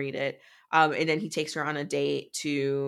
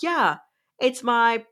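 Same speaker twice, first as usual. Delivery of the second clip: high, loud, cheerful put-on reply; imitated carefree brightness, pitch easing down; breezy mock confidence; 6 semitones higher, 4 dB louder, slower than usual. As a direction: chirp it as if perfectly obvious. Acting Seth's breezy reply to Caleb.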